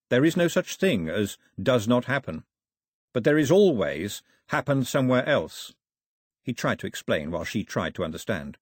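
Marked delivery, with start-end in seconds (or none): none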